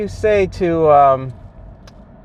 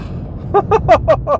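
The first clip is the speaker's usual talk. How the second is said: high exasperated laugh; 10 semitones higher than usual. Real, not acted. Driver fuming over wrong miles.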